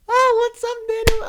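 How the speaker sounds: very high, loud cheerful squeal; sudden drunk delight. Retold or imitated. Drunk friend finally recognizes Daniel, high goofy delight.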